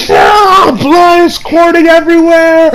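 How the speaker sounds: high, loud mock scream of pain; panicked scream. Retelling the senator's mangled hand, hammed-up panicked scream. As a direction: scream it out, half laughing at the scene. Retold scream of injured man.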